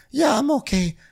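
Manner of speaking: high, slow mock Seema; lightly hammed high voice. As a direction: imitate a high-pitched weary woman. Podcast recap, Seema voiced high.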